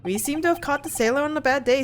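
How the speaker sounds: normal talking voice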